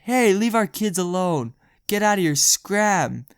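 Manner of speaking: loud angry yell at a stranger. Retold or imitated. Friend's dad yells at the singer to get lost. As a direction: bark it out to chase him off. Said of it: "He just yells this guy".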